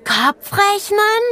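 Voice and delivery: „Kopfrechnen?“ sehr hoch und langsam gedehnt; „Kopfrechnen?“ begeistert staunend hochziehen; erfreute Frage. A narrator's reading. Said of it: "Anton guckte erfreut". (Anton hört von der Rechen-AG: „Kopfrechnen?“ hoch, begeistert)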